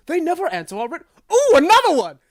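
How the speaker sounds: high, loud, hearty greeting; jovial guardian. Acted. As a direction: hail a hero grandly. GM voices the lions greeting.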